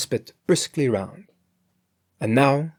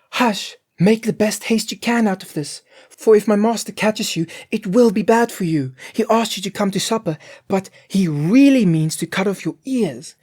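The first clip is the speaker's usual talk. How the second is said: higher, louder urgent cook; urgent warning; 10 semitones higher, 6 dB louder than usual. A narrator's reading. Cook warning the guest to flee, higher and louder. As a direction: scare the guest off in a hurry.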